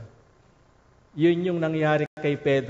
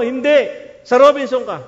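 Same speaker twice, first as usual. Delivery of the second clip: firm refusal; 8 semitones higher, 9 dB louder than usual. Retold or imitated. Pastor refuses firmly.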